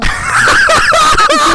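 high, loud squealing laughter; real uncontrolled laughing fit; hysterical laugh. Real, not acted. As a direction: shriek with laughter. Laughs hard at the story.

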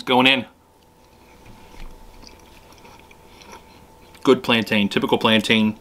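talking with mouth full; words muffled by food; chewing while talking. Real, not acted. Seen 1: Plantain forked in at -4; jaw working, speaks with mouth full after.

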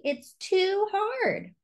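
high and very slow, stretching the word too; whiny Charlie. Acted. Charlie at bedtime complains he can't pick. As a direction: drag it out like a despairing child. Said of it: "said Charlie".

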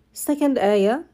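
normal talking voice

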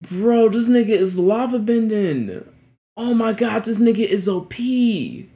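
ordinary talk

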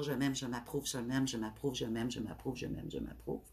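soft mantra fading to a murmur; fading affirmation. Shown as a demonstration. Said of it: "de dire cent fois par jour".

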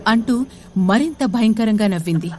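plain reading voice

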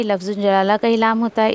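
normal talking voice

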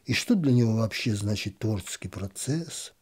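ordinary delivery

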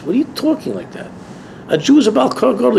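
acted disbelief at an excuse; incredulous retort. Answering someone who claims he can't change, incredulous.

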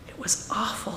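breathless whispered confession; Larry whispering to his mother after the nightmare. A narrator's reading. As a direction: whisper it clinging to mom.

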